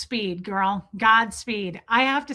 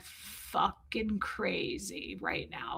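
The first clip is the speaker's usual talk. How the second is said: awed hush; 3 semitones lower, 13 dB quieter, much slower than usual. Retold or imitated. Awed disbelief at Anne.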